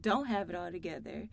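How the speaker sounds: ordinary delivery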